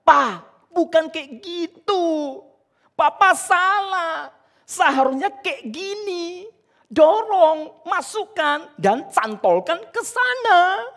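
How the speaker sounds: put-on high, slow childish voice correcting Papa; a little kid bossing his dad, whiny and sure; nagging child. Preacher voicing his son nagging him about the toy, high and bossy. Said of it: "dia sudah merepet".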